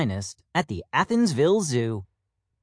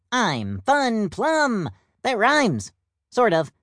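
playful peacock; 7 semitones higher than usual. Acted. Plum jokes about his name.

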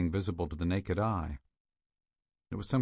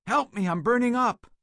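high, loud desperate scream; screaming for help; 7 dB louder than usual. A narrator's reading. Burgess begging his partner for help, agonized scream. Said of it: "Burgess screamed".

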